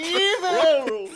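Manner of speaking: high, drawn-out mock cry; hammed-up crusading yell; mock battle cry. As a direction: cry it out long and high like a zealot. Mock outburst about evil sword.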